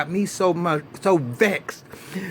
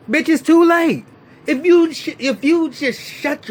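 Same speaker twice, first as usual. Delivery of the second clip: much higher, louder exasperated yell; exasperated outburst; 11 semitones higher, 5 dB louder than usual. Real, not acted. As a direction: blow up at the screen in disbelief. Exasperated rant at Benny.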